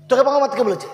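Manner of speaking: তোকে thrown out high and loud. Acted. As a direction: snap it back harder. Repeated louder.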